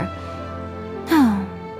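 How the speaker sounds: long exasperated exhale; breathy weary sigh. Acted. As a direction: sigh out, fed up. Exasperated about tengu girl.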